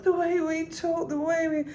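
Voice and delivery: high gentle unpushed croon; not pushed. Shown as a demonstration. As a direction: keep it light and easy up high. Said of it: "it's more like".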